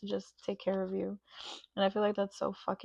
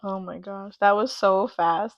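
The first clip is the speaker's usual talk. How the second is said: usual speaking voice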